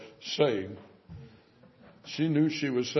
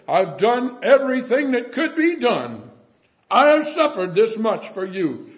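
raised, pleading voice of the Lord; voicing the Lord; 7 semitones higher, 8 dB louder than usual. Preacher voicing the Lord to the unbelieving, raised pleading tone. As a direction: give the Lord's words with hurt urgency. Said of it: "It's as if you'd say".

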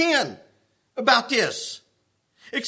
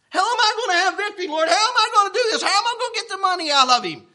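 half-laughing protest; 6 semitones higher, faster than usual. Retold or imitated. Arguing with the Lord.

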